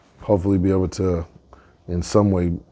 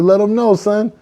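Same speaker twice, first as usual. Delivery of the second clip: high, pushed hype; hype-man urging; 13 semitones higher, 4 dB louder than usual. Acted. Bull urging turkey to show off, high hyped voice. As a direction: egg someone on to boast, pitch up.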